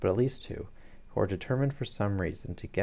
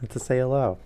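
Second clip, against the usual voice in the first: amused aside; 5 semitones higher, 5 dB louder than usual. Real, not acted. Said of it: "You're pointing guns at people".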